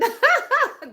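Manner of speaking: sudden high laugh, loud; burst of laughter. Real, not acted. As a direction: laugh out at your own idea. Laughing at her own idea.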